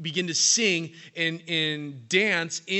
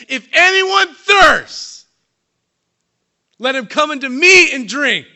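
slow, high, full-voiced proclamation cutting the silence; Jesus shouting out; 9 semitones higher, 14 dB louder than usual. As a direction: cry it out across a hushed crowd. Jesus cries out at silent feast.

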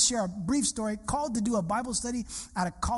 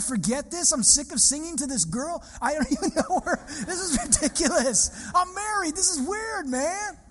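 higher, fed-up complaint with a laugh in it; mock exasperated rant; 6 semitones higher than usual. Pastor imagining quitting the campus worship, exasperated. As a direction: vent like you're done with this.

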